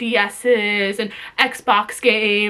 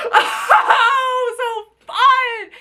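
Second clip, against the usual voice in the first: bitter laugh; 9 semitones higher, much slower than usual. Real, not acted. Bitter laugh after listing grievances.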